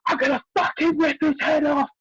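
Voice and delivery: retold rage, high and shouted; furious yelling. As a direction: yell it like an enraged mum backstage. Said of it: "all I could hear from the back is".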